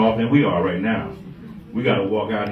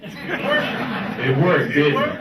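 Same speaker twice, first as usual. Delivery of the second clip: worked said through a laugh, rising; laughing repeat; 3 semitones higher, much slower than usual. Real, not acted. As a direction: laugh it back at them.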